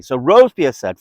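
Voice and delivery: normal talking voice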